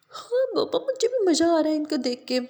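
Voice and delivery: put-on high, excited little girl voice; delighted child seeing the animals. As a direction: squeal with joy at the animals.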